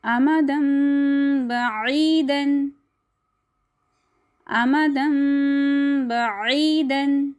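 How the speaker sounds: अमदम बईदन with the tanween turned to nasal म before ब; slow recitation. Shown as a demonstration. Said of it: "इस लफ्ज़ को भी देख लेते हैं". (धीमी तजवीद क़िराअत)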